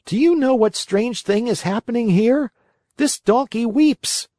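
high puppet voice, calling out; a wooden puppet crying out; jerky creaky wooden puppet. Acted. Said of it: "cried the Marionette".

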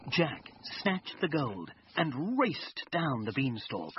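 ordinary delivery, nothing marked

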